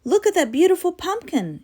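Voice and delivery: put-on high delighted child; exclaiming. Girl shows her brother the pumpkin, high and delighted. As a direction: marvel at the big pumpkin. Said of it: "exclaimed Betty".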